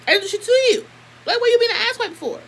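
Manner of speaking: high, loud indignant demand; incredulous high-pitched challenge; retold confrontation. Retold quarrel, confronting girl.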